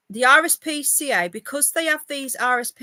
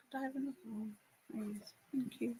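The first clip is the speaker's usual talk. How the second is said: much softer request off to the side; quiet aside; 19 dB quieter, faster than usual. Real, not acted. Vlogger quietly asking family for another, soft aside. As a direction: ask quietly away from the mic.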